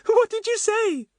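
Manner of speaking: very high, slow, gleeful question; sudden giggle. A narrator's reading. Betts eager to hear Fatty's answer, giggly. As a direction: squeal it slowly with a touch of giggle. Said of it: "with a sudden giggle".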